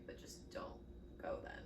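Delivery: dropped to a near whisper; quiet. Real, not acted. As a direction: say it under your breath. Blunt advice, dropped low.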